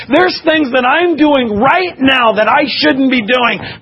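loud, high, impassioned sermon voice; full-force preaching. Real, not acted.

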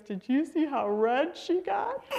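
high, giggly conspiratorial imitation; gleefully teasing dad. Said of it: "he looks at me and he goes".

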